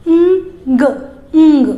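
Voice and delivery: "ंग" as a nasal ng, half n half g; ng sound demo. Shown as a demonstration. Teacher shows kids how to say ng sound. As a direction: hum "ंग" through the nose, g barely touched. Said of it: "आधा न और आधा ग".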